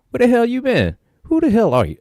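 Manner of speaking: high disbelieving demands; incredulous. Retold or imitated. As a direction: press him hard in disbelief. Kirk interrogates stranger.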